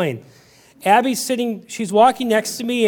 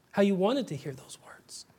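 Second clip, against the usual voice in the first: breathy whispered longing, almost no voice; 9 dB quieter than usual. Acted. Preacher whispering to the congregation about longing. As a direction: let it fall to a whisper of need.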